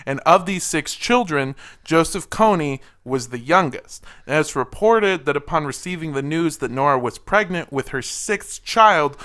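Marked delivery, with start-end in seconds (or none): none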